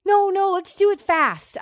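high, drawn-out urging; anxious insistence. Acted. Chalice urging Jalbert to marry sooner, insistent. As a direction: push back in a high, pleading voice. Acted insistence on rushing wedding.

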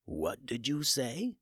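slow, stunned question; stunned disbelief. A narrator's reading. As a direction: ask as if you can't believe your ears. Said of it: "making sure the voice he heard".

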